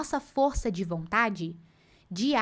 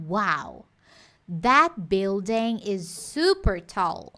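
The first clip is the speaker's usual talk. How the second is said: very slow, building with closed i; slow model sentence; 3 semitones lower, 3 dB louder, much slower than usual. Shown as a demonstration. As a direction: lay out that building is super tall slowly. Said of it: "eu viro ali naquela frase".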